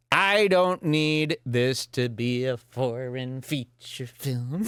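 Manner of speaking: normal talking voice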